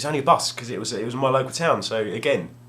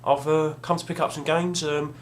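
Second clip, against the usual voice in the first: timid asking; 6 semitones higher than usual. Retold or imitated. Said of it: "And I went".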